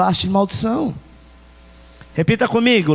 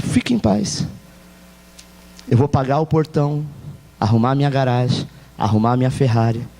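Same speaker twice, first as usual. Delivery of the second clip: calm forgiving; 6 semitones lower than usual. Acted. Gracious man forgives the boy.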